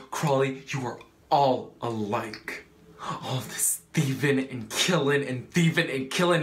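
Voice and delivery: building to a loud, emphatic reproach; heated, mounting condemnation; angry accusation. Acted. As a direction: hurl the charge, leaning on each repetition. Acted accusation of thieving and killing.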